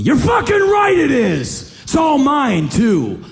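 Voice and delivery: put-on high, loud barking yell at the kid; gruff yelling. Yelling at the homeless boy over the money, loud and high. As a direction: bellow it in his face, slowed on the first line.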